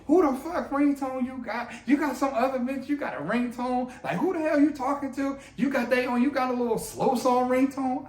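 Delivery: a hammed-up jealous rant about the ringtone; mock jealous wife. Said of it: "she's like".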